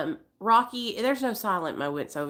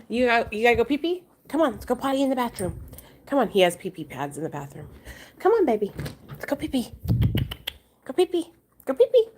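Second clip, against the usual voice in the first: high, fast singsong coaxing, climbing higher and louder at the end; 4 semitones higher, 3 dB louder, faster than usual. Real, not acted. Owner urging her dog to go potty, high baby-talk coaxing.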